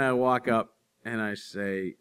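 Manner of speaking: usual speaking voice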